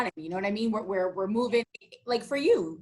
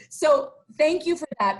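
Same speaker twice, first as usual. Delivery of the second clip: ordinary talk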